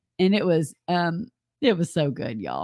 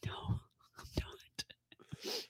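whisper; 21 dB quieter, much slower than usual. Acted. Joking aside about the pearls.

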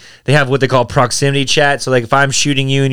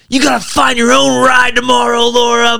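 angry; 8 semitones higher, 3 dB louder than usual. Retold or imitated. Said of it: "I'm just pissed".

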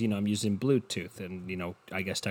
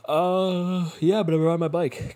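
mock boast; 9 semitones higher, 7 dB louder, slower than usual. Bragging in a put-on voice.

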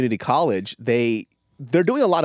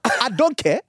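high-pitched mock African scolding; lecturing African accent; 8 semitones higher than usual. African classmate lectures him for lateness, imitated accent, scolding. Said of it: "he would, like, lecture me".

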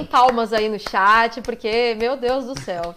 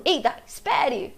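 drawn-out mock call; lightly acted calling out; imitated game catchphrase; 3 semitones higher, slower than usual. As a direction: demonstrate the hero's line slowly. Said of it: "Então manda um".

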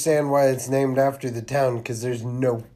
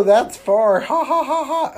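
high, forced ditzy laugh; fake laugh; 11 semitones higher, 5 dB louder than usual. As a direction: overdo a silly giggle. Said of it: "then she's like".

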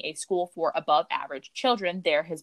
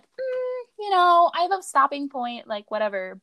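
mock quote; 10 semitones higher than usual. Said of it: "start this off being like".

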